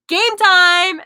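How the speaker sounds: loud, high announcer shout; loud excited sponsor cry; shouted hype. Acted. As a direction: yell the brand name like a hype call.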